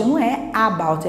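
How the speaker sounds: normal talking voice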